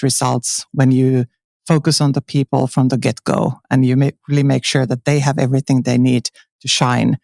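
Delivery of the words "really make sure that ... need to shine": normal talking voice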